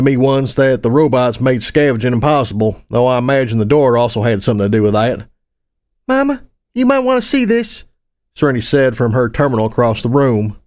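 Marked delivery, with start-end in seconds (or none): 6.1-7.8 high-pitched little pony voice; Serenity's child voice. Acted. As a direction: call to Mama in a high child's voice. Child character calls her mother.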